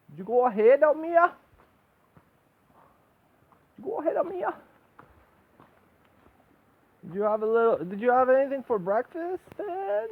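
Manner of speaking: high, loud sing-song pet talk. Real, not acted. Asking the frog about breakfast, high pet talk.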